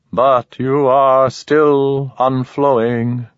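slow, high, otherworldly alien; measured alien, unhurried and odd. Acted. Alien speaks to Ramon.

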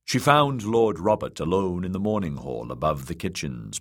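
ordinary delivery, nothing marked

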